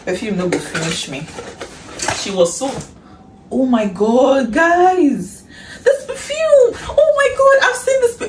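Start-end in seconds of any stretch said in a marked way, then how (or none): 3.5-8.2 high, loud squealing oh my God; excited squeal. Real, not acted. Vlogger finally holding the perfume, squealing with joy. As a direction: shriek with joy.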